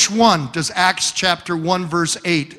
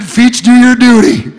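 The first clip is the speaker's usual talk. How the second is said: loud, higher comic battle cry; mock heroic cry; 6 semitones higher, 12 dB louder than usual. Preacher announcing his escape from his wife in a loud mock cry. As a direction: bark it like a retreating soldier.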